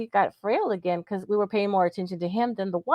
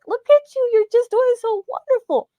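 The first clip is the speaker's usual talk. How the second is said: high, bright sing-song praise, much higher and louder; cheery praise for a patient; 4 dB louder than usual. Retold or imitated. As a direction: gush over the old man like a proud nurse.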